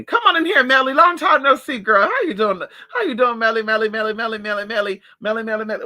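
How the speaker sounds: loud, high, delighted welcome; excited greeting. Real, not acted. Vlogger welcoming friend Melly in, excited and loud. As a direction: greet her like you missed her.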